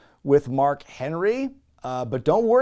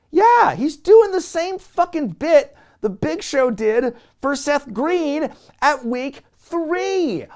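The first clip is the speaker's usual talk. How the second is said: much higher, louder, dragged-out disbelief; exasperated; 12 semitones higher, 7 dB louder, slower than usual. Real, not acted. Reviewer fed up with the repeated bit, exasperated rant. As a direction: vent at the tired joke.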